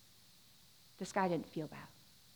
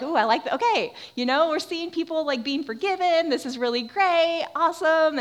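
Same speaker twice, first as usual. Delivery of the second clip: loud, high-pitched enthusiasm; imitated delight; 8 semitones higher, 8 dB louder than usual. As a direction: act out a thrilled listener's approval. Voicing delighted parable listeners.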